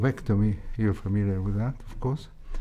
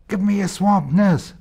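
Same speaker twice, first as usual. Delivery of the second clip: raised, high-pitched imitated yell; retold shout; 10 semitones higher, 7 dB louder than usual. Registrar calling to nurse over a bleeding, retold shout. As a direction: call out loud and high, mimicking a shouting registrar. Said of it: "You shouted at this lady".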